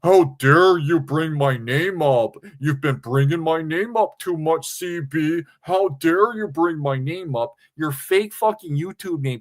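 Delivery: slow, indignant mock voice. Streamer impersonating JT scolding CB. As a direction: ham up his outrage. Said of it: "She's using his name".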